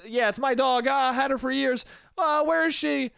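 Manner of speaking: high, put-on distress; fake worried owner. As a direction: play along as the anxious dog owner. Acting fake-worried owner playing along.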